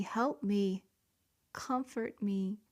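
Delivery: usual speaking voice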